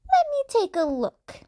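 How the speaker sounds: put-on high, old lady voice; elderly nurse. Nurse starts work.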